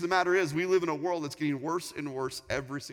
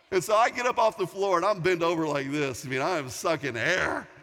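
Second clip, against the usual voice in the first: ordinary talk, nothing marked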